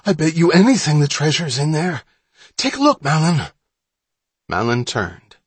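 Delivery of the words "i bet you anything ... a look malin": high, loud, eager urging; excited and urgent, louder and higher; breathless excitement. Acted. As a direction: burst with excitement, pitched up. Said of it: "breathless with excitement".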